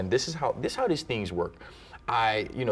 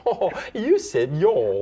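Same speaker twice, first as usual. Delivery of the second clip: mock British accent; 7 semitones higher, 7 dB louder, much slower than usual. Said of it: "It's all the British".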